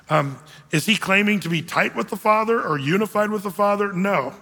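usual speaking voice

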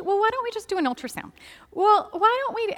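high-pitched, falsely casual suggestion; cagey hedging. Retold or imitated. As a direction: imitate staff tiptoeing round bad news. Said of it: "they don't wanna give too much away".

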